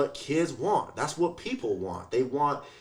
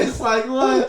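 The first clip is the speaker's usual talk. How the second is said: laughing mock reaction; 13 semitones higher, 9 dB louder than usual. Mimicking the kid's reaction.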